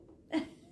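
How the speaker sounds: short breathy giggle; real little laugh at your own joke; amused chuckle. Real, not acted.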